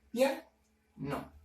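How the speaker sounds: "piano" split as PIA, pause, NO; dividido en sílabas. Shown as a demonstration. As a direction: say "piano" in two separate syllables. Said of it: "Esta palabra se divide". ("piano" silabeado, con pausa entre PIA y NO)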